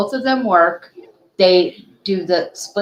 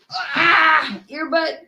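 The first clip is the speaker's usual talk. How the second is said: alarmed yelp; 9 semitones higher, slower than usual. Real, not acted. Puppy grabs earbud.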